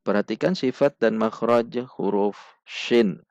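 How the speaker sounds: ordinary talk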